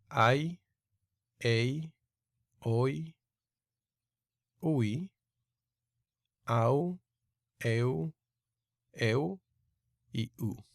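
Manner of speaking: ai, ei, oi, ui, au, eu, eu y u recited one by one with pauses; slow drill of the diphthong sounds; reciting diphthongs. Shown as a demonstration. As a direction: list each Greek diphthong slowly, teacher-style. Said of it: "estos son los ocho diptongos".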